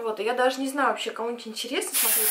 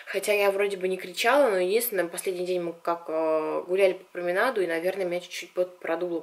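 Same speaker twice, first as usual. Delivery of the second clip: usual speaking voice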